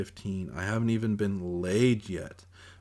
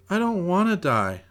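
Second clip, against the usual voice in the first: put-on high little-girl wail, louder; crying; 10 semitones higher, 6 dB louder than usual. A narrator's reading. Little sister crying to her brother, high wail. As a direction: cry it out like a frightened child. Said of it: "she cries".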